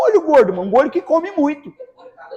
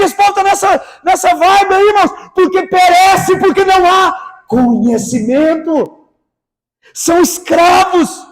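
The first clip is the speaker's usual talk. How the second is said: slow and louder, hammering each phrase; forceful preaching; 5 semitones higher, 4 dB louder, slower than usual. Acted. Pastor to congregation, slow forceful preaching.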